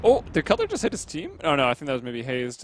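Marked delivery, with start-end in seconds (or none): 0.0-1.3 surprised. Real, not acted. Caster sees a possible teamkill.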